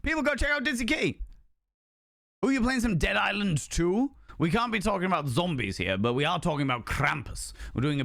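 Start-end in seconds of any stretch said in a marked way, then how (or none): 0.0-4.1 high, fast hype call; excited shout out. Real, not acted. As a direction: pump up the viewers for the raider. Shout out for raider.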